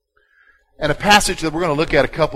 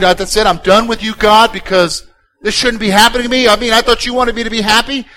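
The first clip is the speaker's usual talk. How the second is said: louder, mock exasperated quitting; fed-up believer; 6 semitones higher, 6 dB louder than usual. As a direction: voice someone giving up on God. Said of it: "Out of your anger, do you decide".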